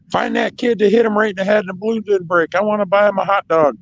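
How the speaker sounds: high-pitched impression of the wife; mock falsetto wife voice; imitating his wife. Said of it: "yeah, my wife".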